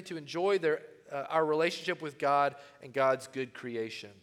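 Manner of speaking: ordinary delivery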